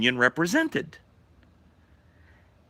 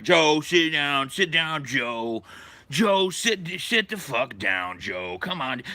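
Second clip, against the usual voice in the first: lightly voiced, drawn-out pleas; pleading coworkers; 3 semitones higher, slower than usual. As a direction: coax him back into his chair. Members trying to calm Joe.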